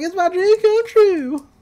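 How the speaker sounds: high, loud, giddy squeal; overjoyed old man. Retold or imitated. An odd old man hears he won the prize, squealing high and loud. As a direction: squeal it like a winner gone giddy. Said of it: "He's like".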